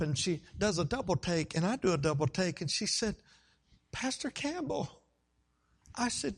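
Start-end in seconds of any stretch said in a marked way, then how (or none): 3.9-5.0 high, slow tearful greeting; tearful woman. Retold or imitated. Distraught wife spotting her pastor in the hospital hall, tearful. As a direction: imitate a weeping woman's surprised recognition. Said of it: "She's crying. She's weeping".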